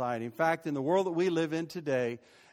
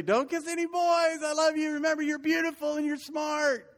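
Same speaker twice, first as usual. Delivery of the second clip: embarrassing dad yell; 9 semitones higher than usual. Retold or imitated. Dad shouting to his daughters at the school drop-off, hammed-up high yell. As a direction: belt it out to embarrass the kids.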